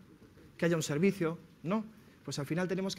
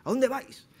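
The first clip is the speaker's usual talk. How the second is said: higher-pitched, mildly aggressive demand; aggressive samurai; 6 semitones higher, 4 dB louder than usual. Acted. Samurai confronting villagers who gather to hear a master, aggressive. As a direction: challenge them like an irritated warrior. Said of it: "todo agresivo".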